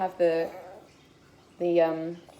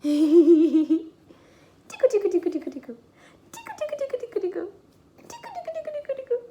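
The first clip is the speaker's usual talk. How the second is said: sing-song tickling, very high; giggly tickle attack; 13 semitones higher, 4 dB louder, faster than usual. Real, not acted. Mum tickling baby playfully.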